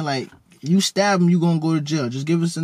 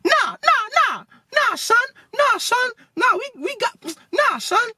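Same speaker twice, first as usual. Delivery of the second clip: very high, squeaky voice out of a big man; Theo's squeaky voice. Retold or imitated. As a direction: squeak every nah up high like Theo. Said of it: "that's exactly how it sounds".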